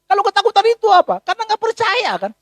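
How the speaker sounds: ordinary delivery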